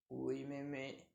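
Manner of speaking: low, soft, slow sly wolf; wolf pretending to obey; wolf faking sweetness. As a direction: purr it like a cunning wolf.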